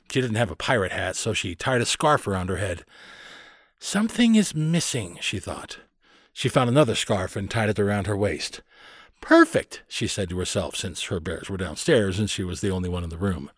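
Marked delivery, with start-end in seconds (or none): 3.8-5.2 put-on higher-pitched child puzzling to herself; pondering little girl. A narrator's reading. Carley checking her pirate outfit, pondering in a girl's voice. Girl's thought while dressing up. 9.2-9.8 very high, louder squeal of satisfaction; delighted little girl. A narrator's reading. Carley alone, done with her costume, delighted.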